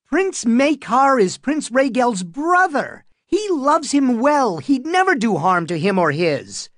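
put-on high, loud boy's voice, insistent; a boy of eleven speaking up sharply; shrill and loud. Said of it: "shrill and loud".

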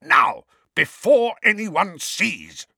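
high, loud, drawn-out angry snarl; furious, strained command; Uncle Vernon's snarl. A narrator's reading. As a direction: snarl the order slowly, forcing out each word. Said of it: "Uncle Vernon snarled".

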